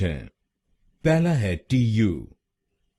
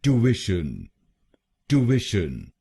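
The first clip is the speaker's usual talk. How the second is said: Tuition as American "too-ISH-un", middle stressed; stress on the middle syllable; slower than usual. Shown as a demonstration. Teacher stressing the second syllable, American style. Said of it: "दूसरे शब्दांश पर यानी IT पर जोर डालना है".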